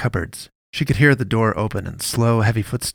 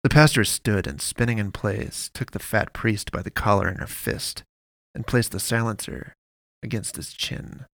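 ordinary delivery, nothing marked

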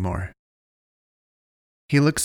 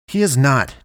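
higher, louder denial; countering; 4 semitones higher, 6 dB louder than usual. A narrator's reading. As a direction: fire it back at him.

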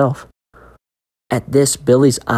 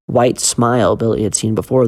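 plain reading voice

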